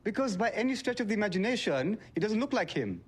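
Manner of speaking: high, exasperated insistence. Acted. Deliveryman insists to the host that he isn't Henry, exasperated. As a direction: argue back in exasperation.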